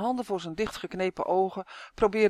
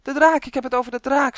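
loud, high-pitched warning; frantic elf shouting; alarmed shout; 7 semitones higher, 8 dB louder than usual. A narrator's reading. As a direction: yell out the danger in alarm. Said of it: "schreeuwde Uf".

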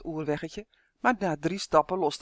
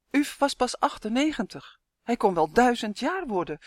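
usual speaking voice

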